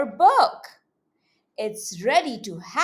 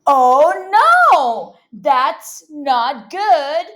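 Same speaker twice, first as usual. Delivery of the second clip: loud "oh no", slow "that's not good"; worried about the growing fire, hammed up; mock alarm; 10 dB louder, slower than usual. Fire getting bigger.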